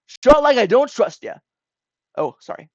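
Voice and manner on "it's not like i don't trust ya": high, loud insistence; emphatic protest. Acted. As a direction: blurt it out loudly in defence. Character protests, first take.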